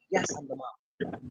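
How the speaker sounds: low, slow and soft; retold patient answer. Mom confirming to confused school parents, retold low and quiet. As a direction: answer quietly, falling away at the end.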